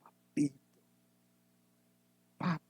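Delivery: tender papito. Retold or imitated. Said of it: "Aquí dice".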